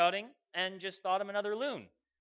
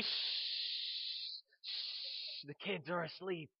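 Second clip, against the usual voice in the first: long shushes, then a hushed warning; soft parent's shushing; hushing; 7 dB quieter, much slower than usual. Acted. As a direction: quietly shush a noisy friend by a sleeping child. Parent hushing the friend again.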